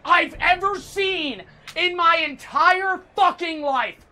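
real gamer meltdown; angry rant. Real, not acted. Ranting about distracting voice lines.

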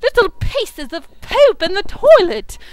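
exasperated grown-up. Pretend. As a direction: rant like a fed-up mother. Kid playing exasperated grown-up.

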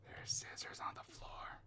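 soft unvoiced whisper. Real, not acted. Player whispering to himself while hiding, hushed. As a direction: breathe it out so nobody hears.

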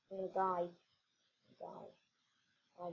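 ordinary delivery

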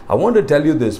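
normal talking voice

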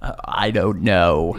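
low, slow groggy morning voice; deep just-woke-up voice. Real, not acted. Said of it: "what is your voice right now".

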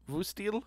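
"Vustil?" said as a rising question, unsure of the vowel; tentative pronunciation try. Shown as a demonstration. Streamer testing the German word with Shion, asking. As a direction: try "Vustil?" like you're checking with her. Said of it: "U is more like a U".

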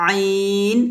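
আইন pressed from the middle of the throat; throaty আইন for the students; ain demo. Shown as a demonstration. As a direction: squeeze আইন out of mid-throat. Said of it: "গলার মাঝখান থেকে অথবা খলকের মধ্যখান থেকে আমরা".

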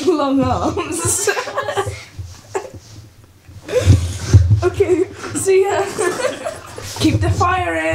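words broken by laughter; laughing. Real, not acted. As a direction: giggle through the line. Laughing at Isaac.

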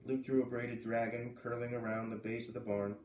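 ordinary delivery

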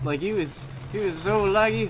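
exasperated. Real, not acted. Frustrated about lag.

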